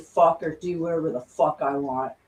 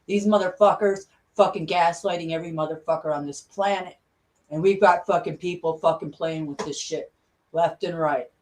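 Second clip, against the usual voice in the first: usual speaking voice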